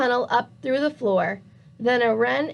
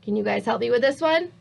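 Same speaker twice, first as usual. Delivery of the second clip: ordinary delivery, nothing marked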